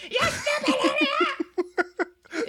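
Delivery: high, drawn-out mock freak-out yell; flipping out. Friend retelling Uncle Jeff flipping out, high mock yell. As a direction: imitate a shrieking meltdown through laughter. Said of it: "starts flipping out and".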